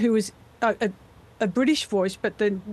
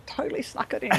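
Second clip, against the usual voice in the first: hushed, whispered confession; softly whispered admission; conspiratorial whisper; 3 semitones higher, 5 dB quieter, faster than usual. Real, not acted. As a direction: whisper it like a sneaky secret. Conspiratorial reply about sneaking it in.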